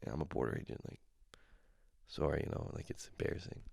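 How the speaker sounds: embarrassed, barely audible mumble; small, low, apologetic voice. Pretend. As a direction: admit it sheepishly, very softly. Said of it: "I'd be embarrassed".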